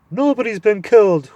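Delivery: high, loud hooting owl. Acted. Owl hooting loudly at the deaf dwarf. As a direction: hoot it into his ear. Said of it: "hooted the owl".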